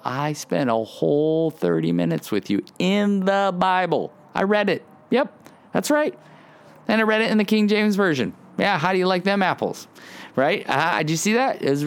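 hammed-up self-congratulation, fishing for a pat on the back; bragging to God. Believer boasting to God about Bible reading, mock smug bragging. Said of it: "looking at God and going".

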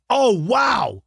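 jolted by a surprise, exclaim it; surprised wow. Real, not acted. Surprised by a picture of himself.

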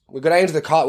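normal talking voice

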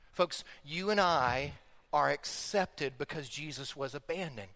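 soft, low, slow sincerity; tender. Real, not acted. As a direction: say it gently, sincerely. Sermon point after story.

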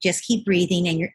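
ordinary delivery

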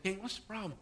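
very soft, caring question; a hushed, kindly question to the king; gentle concern. Retold or imitated. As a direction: ask it gently, almost under the breath. Elisha gently asks the king again.

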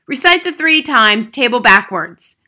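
drill-sergeant headmistress; barked. A narrator's reading. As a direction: bark it at a pupil. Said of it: "the Trunchbull barked".

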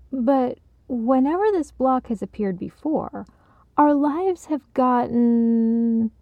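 tentative, left hanging on a long held sound; hesitant trailing thought. A narrator's reading. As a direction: stretch the last word while searching for the next. Hesitant sentence left for Ted to finish.

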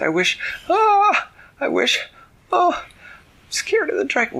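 very high, panicky voice breaking into cries; frightened girl. Acted. Claire tries to wish while held by dragon, frightened. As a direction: gasp and falter in fear, high-pitched.

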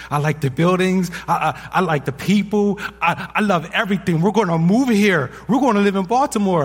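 put-on high, loud, excited boy's voice; excited kid. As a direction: imitate a worked-up ten-year-old gushing. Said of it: "he's very expressive".

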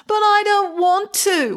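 put-on whining sullen teenager; whiny teen. A narrator's reading. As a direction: drag it out like a sulky teen. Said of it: "whined Snow Pig".

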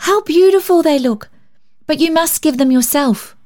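put-on high, bright admiring child; high girlish delight; sparkling delight. A narrator's reading. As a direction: gush over the cakes. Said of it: "cried Polly with sparkling eyes".

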